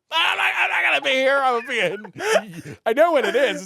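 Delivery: high, fast, loud flustered babble; panicked excuse. Retold or imitated.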